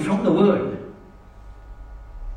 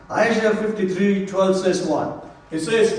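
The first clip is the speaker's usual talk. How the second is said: ordinary talk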